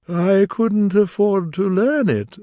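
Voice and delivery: high, slow sighing lament. A narrator's reading. Mock Turtle to Alice, sighing high and slow. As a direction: sigh the words out. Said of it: "with a sigh".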